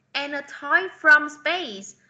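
slow, carefully read English sentence; slow teacher read-aloud; slow model reading. Shown as a demonstration. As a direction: read it slowly for the class. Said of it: "teacher reads the model sentence".

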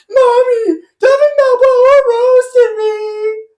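put-on high, loud, slow crying wail of a kid; crying child. Mocking the kid tattling to mom, as a crying wail. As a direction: bawl to mommy like a little kid. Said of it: "he's gonna be like".

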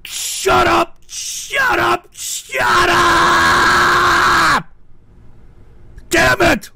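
loud, high "shut up" blast; furious shout. Real, not acted. Streamer shouting over a crude donation, furious.

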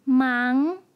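忙 in a rising second tone; 忙 climbing like a question. Shown as a demonstration. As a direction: sweep the pitch upward through 忙. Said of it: "second tone".

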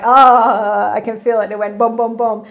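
sudden realization. Retold or imitated. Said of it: "and I was like".

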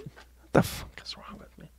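a whispered, self-mocking aside to herself. Real, not acted. Embarrassed at taking the accent request, a whispered aside. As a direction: whisper it under the breath, half to herself.

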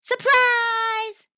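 high, loud, long-held surprise shout; joyful shout. A narrator's reading. Mice greeting Mallow as he arrives, shouted. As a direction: shout it out and stretch the last syllable. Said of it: "they shouted as Mallow arrived".

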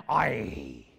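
deep Scottish aye; lightly mock Scottish aye; a quick Scottish aye, deep and low. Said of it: "if he was Scottish".

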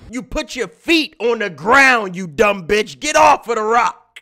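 loud, high scolding shout; exasperated yelling. Real, not acted. Streamer ordering the panicking climber down, exasperated shouting. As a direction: yell orders at someone who won't listen.

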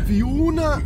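high, weak attempt at the Fiona call; a clumsy copy of the Eurotrip yell; bad Fiona imitation. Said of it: "That was crap".

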